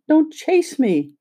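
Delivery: high, slow, frightened plea; slow frightened high cry. A narrator's reading. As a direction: draw it out, small and scared, pitched high. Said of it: "She had such a frightened little voice".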